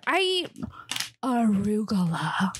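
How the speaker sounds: "arugula" breathed slow and hushed like a luxury; snooty hushed reveal of the word "arugula"; mock posh whisper. Shown as a demonstration. Teasing viewers about fancy lettuce, a mock-posh whisper. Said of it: "you're high class".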